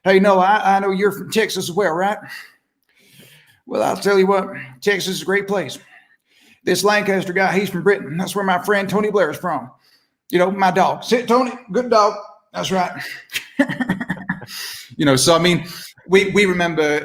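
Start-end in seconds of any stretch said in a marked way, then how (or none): 0.0-2.2 higher-pitched Texan presidential voice; folksy George W. Bush; George Bush impression. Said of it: "things George Bush used to say". 3.6-12.9 higher-pitched Texan presidential voice; folksy George W. Bush; George Bush impression. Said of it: "things George Bush used to say". 13.0-14.8 high, soft snickering laugh; quiet wheezy chuckle; Bush-style chuckle. Acted.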